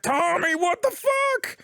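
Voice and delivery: high, drawn-out mock yell; acted shout. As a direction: yell it at your brother, stretching it out. Retelling Joel shouting at Tommy in fight.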